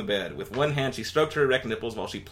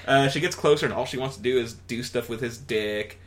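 normal talking voice

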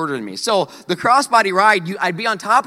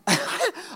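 short laugh; 14 semitones higher, 6 dB quieter than usual. Real, not acted. Laughing at his own story.

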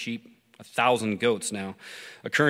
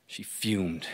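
plain reading voice

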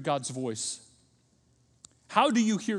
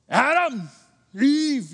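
loud, raised angry call; angry God; 9 semitones higher, 10 dB louder, much slower than usual. Acted. Preacher mimicking God calling Adam and Eve, angry. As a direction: call out the names in anger. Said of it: "you hear that anger".

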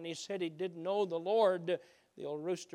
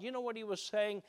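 ordinary talk, nothing marked

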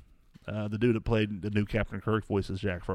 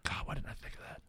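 whispered to himself; 5 semitones higher, 16 dB quieter than usual. Retold or imitated. Thinking aloud about the plot.

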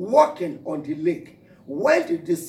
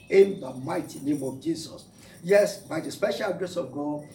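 ordinary talk, nothing marked

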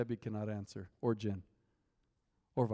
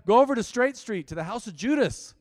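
much higher and louder, proclaiming; booming voice of the Lord; 9 semitones higher, 7 dB louder than usual. Retold or imitated. The Lord commands Ananias, booming and loud. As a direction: call it out like God commanding. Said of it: "So the Lord said to Ananias".

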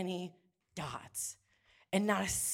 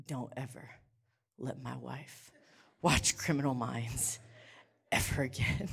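grave low warning; 8 semitones lower, 4 dB quieter than usual. Retold or imitated. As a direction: growl it out with deadpan weight. Husband's slow grave warning.

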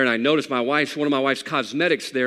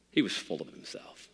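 whispered repeat; 3 semitones lower, 8 dB quieter than usual. Acted.

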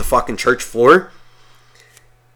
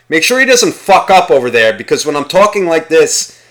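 higher-pitched mock priest giving orders; imitated priest, raised voice; imagined priest; 4 semitones higher, 4 dB louder than usual. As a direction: play a self-important priest instructing from the altar. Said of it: "like, the priest being like".

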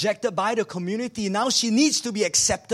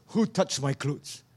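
slow puzzled question; 5 semitones lower, slower than usual. Acted. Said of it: "he turns and he goes".

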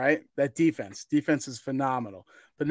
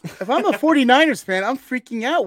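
excited freak-out; 7 semitones higher, 5 dB louder than usual. Real, not acted. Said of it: "in a positive way".

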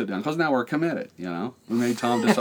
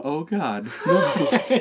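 rising panicked plea, higher and louder on no; mock panic; 7 semitones higher, 4 dB louder, much slower than usual. Half-laughing horrified squeal. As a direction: son dreading dad's move, squeals a laughing oh God no. I'm going.

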